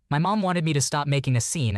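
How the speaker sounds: plain reading voice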